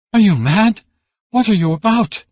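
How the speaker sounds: disbelieving outcry in a high voice; shocked protest. A narrator's reading. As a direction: niece protesting to her raging uncle, high and loud. Niece protesting the uncle.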